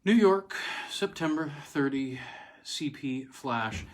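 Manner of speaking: crisp news-wire dispatch read; wire flash read. Acted. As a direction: call it out like a teletype bulletin.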